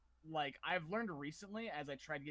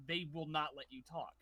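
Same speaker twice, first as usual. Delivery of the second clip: ordinary talk, nothing marked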